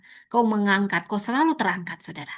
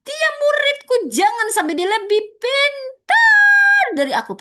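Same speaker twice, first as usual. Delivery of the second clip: shrill mock of a jealous mentor; jealous teacher; 7 dB louder than usual. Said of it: "Pakai begitu lagi gayanya".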